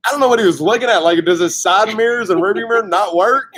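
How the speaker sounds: high, exasperated mock yelling; wished-for yell. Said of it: "that's what I wanted to yell at him".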